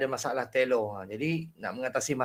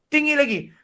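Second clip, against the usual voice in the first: higher, louder call to go up; imitating a teacher saying go higher; mock coach urging higher; 9 semitones higher, 4 dB louder than usual. Shown as a demonstration. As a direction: push the reciter to go higher.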